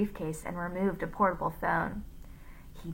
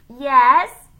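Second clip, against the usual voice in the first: very loud, high donkey-like "yes?"; donkey with tonsillitis; 6 semitones higher, 15 dB louder than usual. A narrator's reading. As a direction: bray it like a sore-throated donkey. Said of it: "he sounded like a donkey with tonsillitis".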